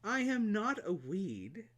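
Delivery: slow, high, sweet flower; a touch of hurt sweetness; sweetly. Acted. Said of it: "replied sweetly".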